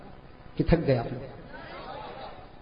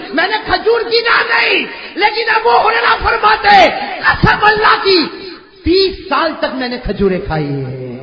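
preaching climax; 5 semitones higher, 5 dB louder than usual. Acted. Climax of sermon oath.

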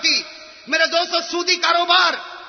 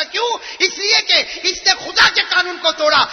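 fiery preaching; 6 semitones higher, 4 dB louder than usual. Acted. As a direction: preach it to the whole mosque. Warning about shame on parents. (پرجوش خطبہ، بلند آواز میں)

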